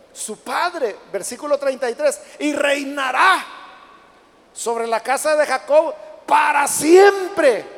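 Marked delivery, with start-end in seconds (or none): 2.4-7.7 slow, shouted preacher's declaration; emphatic proclamation. Acted. Angel promising Mary an endless reign, proclaimed loudly. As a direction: build to a loud, drawn-out finish.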